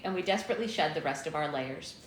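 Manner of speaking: ordinary talk, nothing marked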